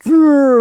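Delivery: high, loud playful growl; kids' growl. Retold or imitated. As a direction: growl like a clinging little kid. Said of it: "like this".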